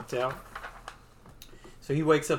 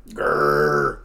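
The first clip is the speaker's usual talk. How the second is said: deep wolf growl, loud; a growling wolf springing at Jonah; low growl; 11 semitones lower, 8 dB louder than usual. Acted.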